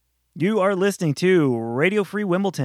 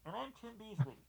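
muffled, far-off mic voice; underwater; 7 semitones higher, 22 dB quieter than usual. Retold or imitated. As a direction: sound like talking from underwater. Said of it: "I'm gonna show you what Tim sounded like".

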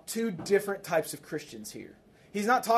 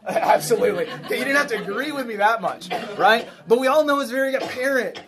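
laughing; 6 semitones higher, 6 dB louder than usual. Real, not acted. Laughing at the congregant's answer.

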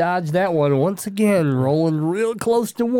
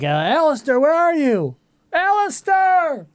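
calling a lost friend, rising to a high drawn-out yell; 11 semitones higher, slower than usual. Pretend. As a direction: shout his name into the dark woods. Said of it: "I'm just gonna yell out to him".

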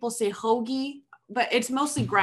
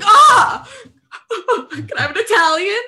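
mock excited order; 11 semitones higher, 11 dB louder than usual. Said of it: "I'm like".

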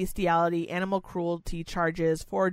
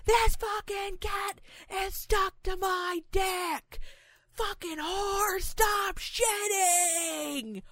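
high, drawn-out strained mock scream; imitated screaming; 12 semitones higher, much slower than usual. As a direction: stretch every word into a hammed-up scream. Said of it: "he was screaming so loud".

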